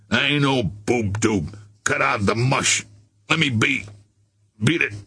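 surly street tough, brushing her off; gruff mucker pushing her away; scowling tough guy. A narrator's reading. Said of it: "scowling at her".

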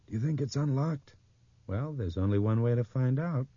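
high, lifted voices trading question and answer; overheard character voices. A narrator's reading. Two people outside a door deciding, high overheard voices. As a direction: pitch it well up, lilting.

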